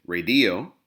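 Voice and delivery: "radio" said ra-DI-o; stress on middle syllable. Shown as a demonstration. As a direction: misplace the stress in "radio" onto the I. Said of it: "we could also say".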